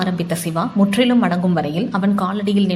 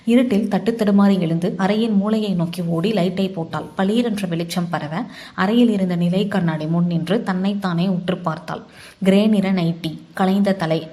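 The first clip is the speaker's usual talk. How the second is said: plain reading voice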